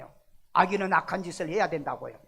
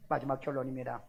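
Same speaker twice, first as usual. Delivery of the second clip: normal talking voice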